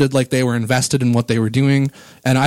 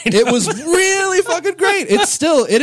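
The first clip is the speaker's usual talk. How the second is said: high, slow, drawn-out rave about the show; gushing excitement. Real, not acted. Fan gushing to co-hosts about a concert, high and excited. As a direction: let it soar up with pure delight.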